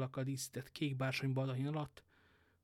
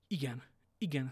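plain reading voice

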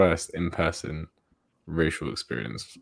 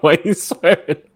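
higher, louder mock scolding, half laughing; imagined mum; 11 semitones higher, 11 dB louder than usual. Speaker imagines mum overhearing him swear, louder mock scolding. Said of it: "my mom's gonna be like".

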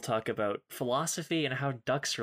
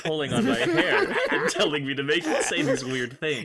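laughing; 6 dB louder than usual. Real, not acted. Laughing mid-sentence.

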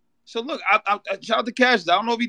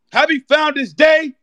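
festive shout; 7 semitones higher, 4 dB louder than usual. Real, not acted. Fraternity shout-out.

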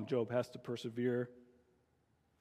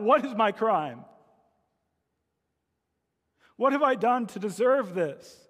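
higher, louder cry while being beaten; 5 semitones higher, 3 dB louder than usual. A narrator's reading. Edmund crying out as the jailers beat him, pained raised plea. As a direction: plead out through the pain. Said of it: "he cries out".

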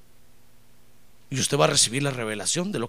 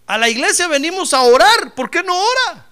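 loud, high preacher's challenge; fiery preaching; 12 semitones higher, 10 dB louder than usual. Acted. Pastor scolding members who don't pray, loud high preaching. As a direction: thunder it at the church.

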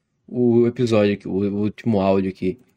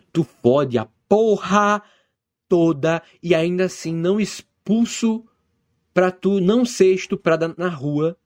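indignant rant; 6 semitones higher than usual. Acted. Father berating the daughter he took in, indignant. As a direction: draw out each grievance in raised pitch. Father's acted retort in quarrel.

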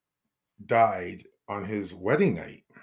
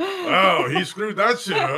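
high, loud jeering soldier voice; mocking soldier; 10 semitones higher, 7 dB louder than usual. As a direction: jeer it out like a rowdy soldier. Army voiced mocking drowned emperor.

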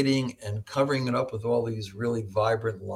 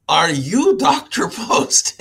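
slow, loud, higher Eastern European voice; Eastern European accent; 10 semitones higher, 8 dB louder, much slower than usual. Retold or imitated. An imposing colleague sizing up the new doctor, slow mock Eastern European accent. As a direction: loom over the newcomer, imitating a slow Eastern European accent. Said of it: "sounds Eastern European".

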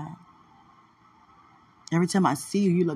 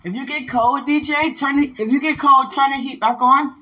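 louder and higher, calling across the house; 7 semitones higher, 6 dB louder than usual. Real, not acted. Reminding D J about the heat while leaving, loud call. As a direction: call it out so he hears from another room.

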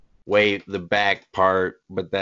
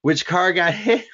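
laughing, rising question; asking with a laugh; excited; 8 semitones higher than usual. Retold or imitated. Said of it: "We're like".

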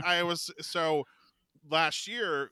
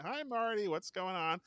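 high, singsong hello; mock innocent greeting; 8 semitones higher than usual. Fan hiding tape greets actor, high innocent voice. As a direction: greet lightly, as if hiding something.

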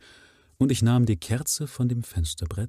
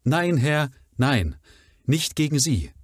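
higher, loud plea, stress on "nein" and "gegen"; pleading woman's cry; 6 semitones higher, 4 dB louder than usual. Shown as a demonstration. Mrs Barrymore protests her loyalty to Sir Henry, pleading. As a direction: beg him to believe her. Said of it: "das passiert beim Prima Vista Lesen".

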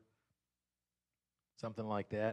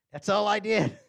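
laughing admission; 7 semitones higher, 10 dB louder than usual. Retold or imitated.

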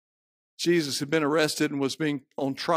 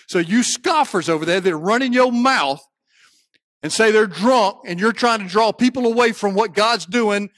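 loud, high rebuke from the pulpit; fiery preaching; 8 semitones higher, 9 dB louder than usual. Real, not acted. Preacher taking on the scoffers who say they're drunk, fiery preaching. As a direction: press hard, climbing higher as it goes.

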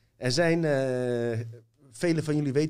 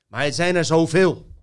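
loud outburst; 5 semitones higher, 10 dB louder than usual. Real, not acted. Rant about abuse cases.

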